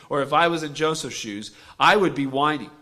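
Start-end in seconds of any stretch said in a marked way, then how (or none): none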